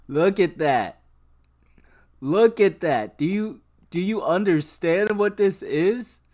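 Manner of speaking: loud, high, incredulous; almost shouting in disbelief; real shock at the chart; excited disbelief. Real, not acted. Shocked at the scam stock.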